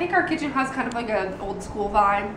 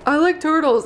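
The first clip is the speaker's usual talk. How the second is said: higher, lightly put-on kid's voice; imitating little boy; 4 semitones higher, 5 dB louder than usual. Correcting a friend about the fair video, mock little boy. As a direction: repeat the interviewed boy's line. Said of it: "No, he's like".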